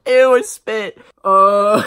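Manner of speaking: 高而响的嫌恶声; 被自己恶心到，大声喊出来; grossed out. Real, not acted. Spat while painting.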